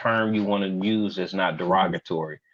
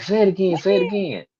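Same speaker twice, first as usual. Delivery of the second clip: high, playful say it again; mock teasing chant; teasing friends; 11 semitones higher, much faster than usual. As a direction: egg him on, much higher. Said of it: "they was laughing".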